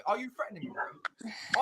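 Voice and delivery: whispered challenge. Real, not acted. Challenging Roy on call.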